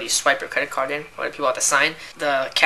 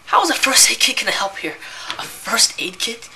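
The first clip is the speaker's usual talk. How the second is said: incredulous scorn; 7 semitones higher than usual. Real, not acted.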